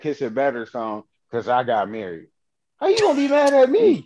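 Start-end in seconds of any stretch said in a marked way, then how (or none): 2.8-4.1 mock [name] voice. Voicing [name]'s complaint.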